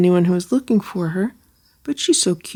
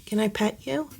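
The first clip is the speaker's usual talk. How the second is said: ordinary talk, nothing marked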